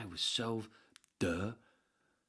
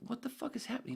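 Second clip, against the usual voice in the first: much higher, baffled outburst; incredulous; faster than usual. Retold or imitated. Storyteller recalling the teacher interrupting, baffled outburst. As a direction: gasp it out in disbelief. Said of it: "It was like".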